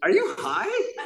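very high imitation of his wife, laughing; wife's voice. Wife catches him high, mimicked very high. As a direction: squeak it out as the shocked wife. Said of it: "She's like".

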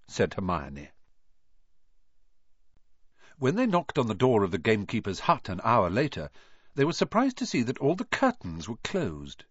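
plain reading voice

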